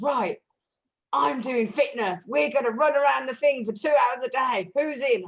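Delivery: a hint of a pushy fitness friend; imitating bossy friend. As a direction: round everyone up for a workout. Friend announcing fitness regime.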